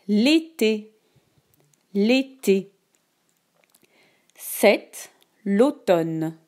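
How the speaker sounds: slow, clear dictation voice; dictating. Shown as a demonstration. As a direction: read each word slowly for dictation. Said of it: "Écoute attentivement la dictée".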